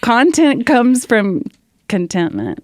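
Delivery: preaching her discovery to the host; emphatic reveal. Real, not acted. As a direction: land the big insight with force. Punchline of her word insight.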